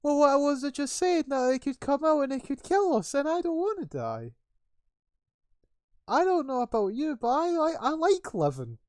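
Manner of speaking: high, loud panicky babble; frightened guard. Acted. Guard tells colleague they don't want to die, panicky. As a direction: plead for your life at a shrieky pitch.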